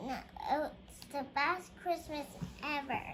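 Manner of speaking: plain reading voice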